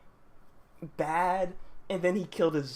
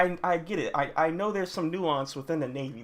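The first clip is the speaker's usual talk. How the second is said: ordinary talk, nothing marked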